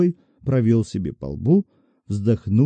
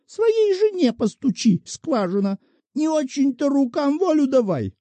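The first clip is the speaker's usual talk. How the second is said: put-on offended old woman; 14 semitones higher than usual. A narrator's reading. Old woman snapping back at the clerk, offended and much higher. Shchukina voiced by narrator.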